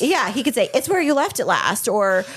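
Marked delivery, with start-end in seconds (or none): 0.7-1.9 higher, snippy defensive answer; defensive reply. Retold or imitated. Husband snapping back at his wife, mildly defensive. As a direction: toss it back as a mild mock defense. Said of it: "it's taken as a defense".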